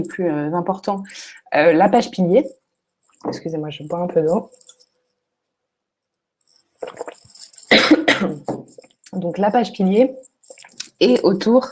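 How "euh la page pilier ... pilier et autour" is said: losing voice. Real, not acted. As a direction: voice giving out, hoarse. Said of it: "ah, j'ai plus de voix".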